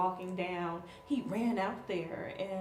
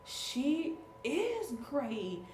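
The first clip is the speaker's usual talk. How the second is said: very slow, higher, drawn-out praise; slow, admiring; 6 semitones higher, much slower than usual. Retold or imitated. Nine-year-old praising the candidate, slowly and admiringly. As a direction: savour each word in awe. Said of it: "nine-year-old was like".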